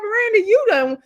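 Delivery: sassy high callout. Real, not acted. Viewer scolding Miranda through the screen, sassy high callout. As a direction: call her out like a fan at the TV.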